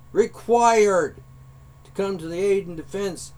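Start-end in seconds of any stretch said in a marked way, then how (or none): 0.1-1.2 "required" punched out louder and higher; stressed word. Real, not acted. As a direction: hammer "required" on its own after a pause. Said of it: "I repeat".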